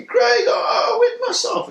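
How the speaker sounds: loud, very high-pitched mock wail; imitated crying. Imitating his scared friend confessing to him, high tearful wail. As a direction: wail it like a frightened crying boy. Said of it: "and he's crying".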